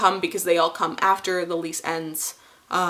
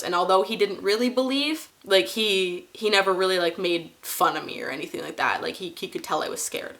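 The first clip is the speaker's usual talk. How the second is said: ordinary delivery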